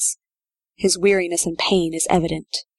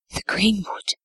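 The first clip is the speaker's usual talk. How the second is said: breathed; 3 semitones higher than usual. Acted. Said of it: "she breathes".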